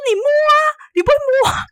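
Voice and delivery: high, fast exasperated retort; high-pitched fast mock scolding. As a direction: throw it back at him, loud and quick. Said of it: "哎，我说".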